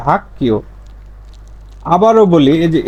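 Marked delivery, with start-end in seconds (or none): 0.1-0.6 "hak-kio" for হাক্কিয়, no g sound; corrected k reading. Shown as a demonstration. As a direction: say হাক্কিয় with a voiceless double k. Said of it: "কিন্তু উচ্চারণ করবেন এভাবে".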